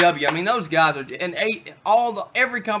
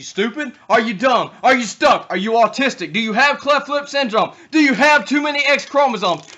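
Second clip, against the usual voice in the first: loud, high taunting jabs; mock rant; 6 semitones higher, 5 dB louder than usual. Host taunting viewers who skip the show, loud and high. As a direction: rattle off insults in a rising yell.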